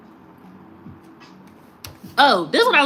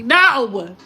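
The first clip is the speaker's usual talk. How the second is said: emphatic refusal; 6 dB louder than usual. Acted. Interrupting the mocked invitation.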